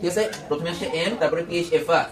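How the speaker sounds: ordinary delivery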